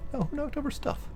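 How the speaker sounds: startled squeak at the noise; surprised high voice. Real, not acted. Pet knocked something over.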